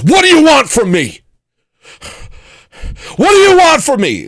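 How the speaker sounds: high, loud frantic shouting; mock panicked yell. The paranoid stranger yells across the lot at the helper, high frantic shout.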